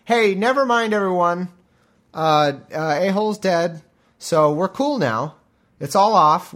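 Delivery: acted soldier announcing a halt; calling off troops. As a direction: call out awkwardly to everyone on the battlefield. Soldier calls off the war.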